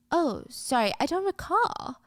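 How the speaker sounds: high, airy fake innocence; mock feigned ignorance. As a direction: pretend you can't place her at all. [name] feigns not knowing Ari.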